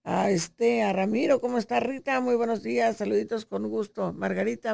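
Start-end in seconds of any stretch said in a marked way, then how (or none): none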